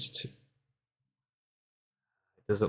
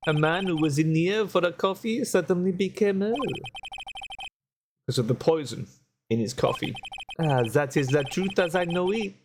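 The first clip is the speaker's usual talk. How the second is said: lightly put-on French accent, café owner voice; 2 semitones higher than usual. Café owner telling the lawyer what happened, light French accent. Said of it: "I hate doing the accent".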